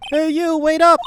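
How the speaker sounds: high, loud call across the bridge; yelling after them. Acted. Stranger calling after Maya and Nick, loud high yell. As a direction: shout to stop them. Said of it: "I think he's yelling at us".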